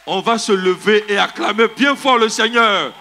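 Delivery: ordinary talk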